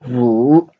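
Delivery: dipping Mandarin tone on Wu; third tone. Shown as a demonstration. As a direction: draw Wu down and swing it back up. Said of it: "the third tone".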